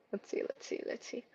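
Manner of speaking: very soft, unvoiced whisper; muttering to self. Real, not acted. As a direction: whisper it under your breath. Muttering to self while searching.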